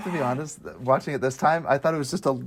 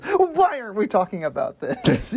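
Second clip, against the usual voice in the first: high-pitched indignant challenge; evil passenger; 7 semitones higher than usual. Acted. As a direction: imitate an objecting villain, pitched way up. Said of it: "And then he goes".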